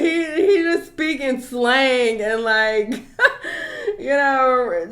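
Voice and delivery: loud, slowed words broken by laughter; can barely get it out for laughing; laughing hard. Real, not acted. Mocking how he learned English, cracking up loudly. Mocking friend's slang source.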